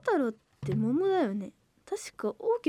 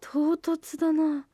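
usual speaking voice

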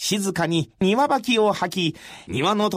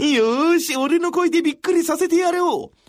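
higher, keen and boastful; eager boast; 8 semitones higher than usual. A narrator's reading. Masanori eager to startle Rikyu, keen boast. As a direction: puff up, ready to show off a big voice.

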